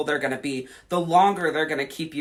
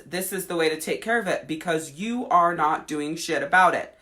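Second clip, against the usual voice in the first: ordinary talk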